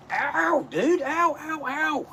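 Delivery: sharp high yelps of pain; high-pitched yelps of pain. Real, not acted. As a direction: yelp as the claws dig in. Cat clawing him.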